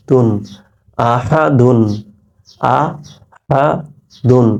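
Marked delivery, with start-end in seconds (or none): 2.8-4.5 আহা with a clearly breathed হা, then দুন; careful demo of আহা দুন; careful হা sound. Shown as a demonstration. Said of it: "হা উচ্চারণ ঠিক রাখবেন".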